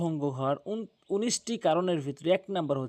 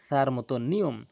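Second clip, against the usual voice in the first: ordinary talk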